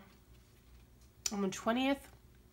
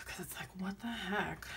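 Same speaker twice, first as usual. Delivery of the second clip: whisper; 5 dB quieter than usual. Real, not acted. Whispered aside about throat.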